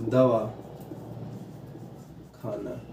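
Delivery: दवा, a long pause, then खाना low and soft; pause between words. Shown as a demonstration. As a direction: split दवा and खाना with a clear silence. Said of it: "इनके बीच में जो अल्प मौन".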